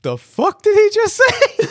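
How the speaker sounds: high, loud, incredulous squeak; shocked movie quote. Retold or imitated. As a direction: squeal it in disbelief. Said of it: "he goes".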